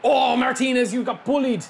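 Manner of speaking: loud, high jeer at the player; excited shout. Real, not acted. Streamer cheering his player's tackle, excited shout. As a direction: shout it out gleefully.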